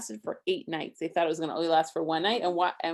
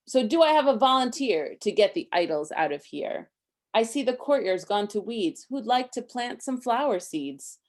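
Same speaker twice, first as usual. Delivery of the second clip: lively rhyming read-aloud, a bit higher and louder; story reading; 5 semitones higher, 5 dB louder than usual. A narrator's reading. Parent reading a Hanukkah book to Isaac, lively rhyme. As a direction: read the rhymes to a child with bounce.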